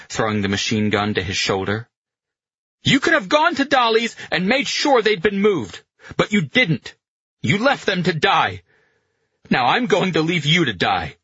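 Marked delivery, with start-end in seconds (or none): 2.8-11.2 high, heated shouting, easing slightly at the end; shouted accusation. A narrator's reading. Pino threatens the general who left them to die, shouting. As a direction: hurl the blame at him, loudly. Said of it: "Pino shouted".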